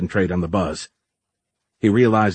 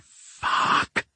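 voiceless whispered curse; mouthed; 3 dB quieter than usual. A narrator's reading. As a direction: breathe the word without voice. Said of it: "mouthed the word".

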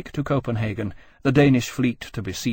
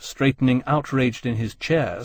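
usual speaking voice

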